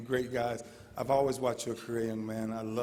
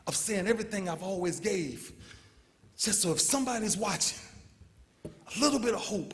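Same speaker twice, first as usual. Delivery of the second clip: emotional; 7 semitones higher than usual. Real, not acted. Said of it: "I tried not to get emotional".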